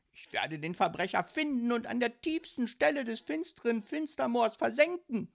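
high, loud, agitated tirade; furious rant. A narrator's reading. Ghost cursing the postman who stole his letter, ranting. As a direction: rage and vow revenge on the thief.

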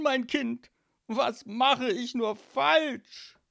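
lamenting mafia papa, swelling into high, loud outbursts; exasperated godfather father. Acted. Godfather laments to his daughter, exasperated and swelling loud. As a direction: despair theatrically at your child. Plays despairing godfather Don Calzone.

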